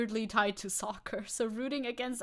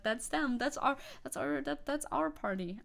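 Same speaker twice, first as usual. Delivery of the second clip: ordinary talk, nothing marked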